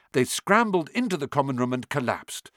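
plain reading voice

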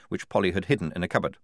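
ordinary delivery

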